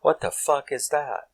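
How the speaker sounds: startled, high and hushed; a hushed, startled outburst; shocked what-the. Retold or imitated. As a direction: react like a spooky voice just spoke. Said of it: "And I'm like".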